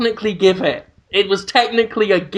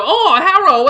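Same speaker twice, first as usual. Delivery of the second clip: much higher, louder mocking caricature voice; 11 semitones higher, 4 dB louder than usual. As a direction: parody a crude TV caricature. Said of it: "paint your face yellow and go on T V and go".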